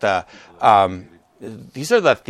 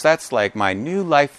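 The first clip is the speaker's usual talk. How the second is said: ordinary talk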